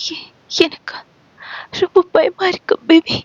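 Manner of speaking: high trembling whisper of fear; high, choked, tearful voice. Acted. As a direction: girlfriend barely getting the words out. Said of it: "தழுதழுத்த குரலில் சொன்னாள்".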